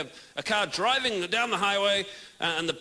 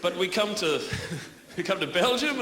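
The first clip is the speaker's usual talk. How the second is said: ordinary delivery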